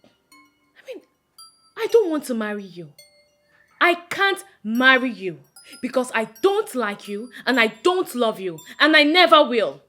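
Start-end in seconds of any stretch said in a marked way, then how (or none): 1.8-9.8 loud, forceful refusal; angry rejection. Acted. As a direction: build to an emphatic, final no. Rejects suitor's proposal.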